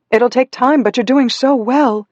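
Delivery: put-on high-pitched, emphatic reassurance to a child; encouraging parent. A narrator's reading. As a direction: lift your voice to cheer a child on.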